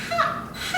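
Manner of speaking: "Ha, ha" very high and soft with an open throat. Shown as a demonstration. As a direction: float "Ha, ha" high and light, palate lifted. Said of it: "raise your soft palate without making that noise".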